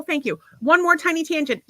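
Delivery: ordinary talk, nothing marked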